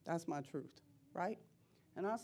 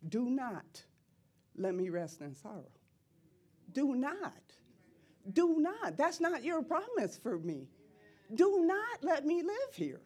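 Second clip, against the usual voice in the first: fervent prayer shout; 6 semitones higher, 5 dB louder than usual. Retold or imitated. Preacher getting in her Father's face, shouting. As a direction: demand it of God, building to a cry.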